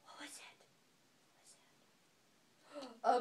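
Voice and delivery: mock-amazed whisper; breathless storybook whisper; hushed gasp. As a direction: gasp then whisper to the baby. Gasp reading to baby.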